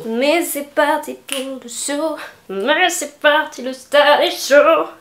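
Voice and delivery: slow, drawn-out show host intro; stretched showbiz opening; announcer voice. Pretend.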